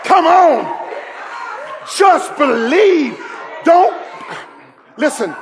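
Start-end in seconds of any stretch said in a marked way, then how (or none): 0.0-4.0 loud, high shouted preaching; preaching at full force. Acted. Preacher shouting to the church, full force. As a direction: proclaim it to the congregation.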